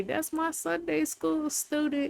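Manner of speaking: high-pitched proud-teacher imitation; imitating Sunday school teacher. Teacher claiming her student, imitated in a high voice. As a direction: voice the teacher's pet phrase up high. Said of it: "she would always say".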